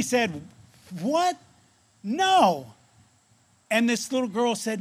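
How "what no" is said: high startled question, then a firm refusal; shocked disbelief. Retold or imitated. Stephanie answers her friend's news, in shocked disbelief. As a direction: react in disbelief, word by word.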